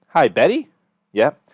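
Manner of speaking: upbeat sales-call hello; friendly excited tone. Shown as a demonstration. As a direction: greet her like an old friend, full of energy. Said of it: "So I like to use a friendly, warm, enthusiastic, energetic, and excited tone".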